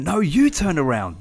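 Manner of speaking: high-pitched stress on "you", falling to the end; audience talking back. Retold or imitated. Podcaster as the next audience answering, stressed high "you". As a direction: lift "you" sharply as a retort. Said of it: "Then an audience going".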